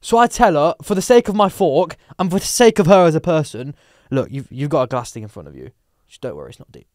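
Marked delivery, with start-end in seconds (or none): 4.1-6.9 low, soft reassurance; calm someone who is scared, very quietly; hushed, soothing aside; comforting. Retold or imitated. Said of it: "I've comforted her".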